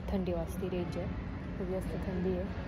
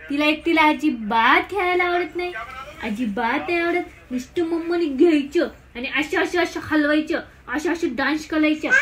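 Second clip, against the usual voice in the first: higher-pitched, playful cooing scolding; 5 semitones higher than usual. Real, not acted. Mother recounting her baby's crying and demands, baby talk.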